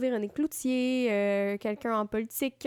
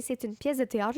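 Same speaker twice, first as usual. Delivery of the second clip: normal talking voice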